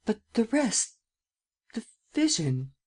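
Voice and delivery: high, hushed anxious asking. A narrator's reading. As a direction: ask anxiously, as if dreading the answer. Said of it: "bending forward anxiously".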